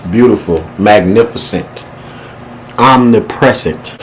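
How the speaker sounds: slow, drawn-out big words; slow savoring. Real, not acted. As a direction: relish each fancy word slowly.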